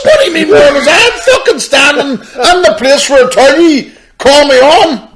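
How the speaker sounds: high laughing outburst, half shouting; mock angry shout. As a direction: yell it back at him while cracking up. Retelling a heated row, laughing.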